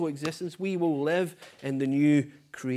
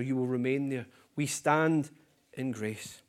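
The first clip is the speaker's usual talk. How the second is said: ordinary talk, nothing marked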